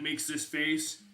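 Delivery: ordinary talk, nothing marked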